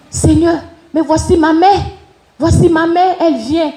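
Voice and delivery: a high, eager cry to God; excited prayer. Retold or imitated. Praying aloud as she spots her mother, excited. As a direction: point her out to the Lord, thrilled.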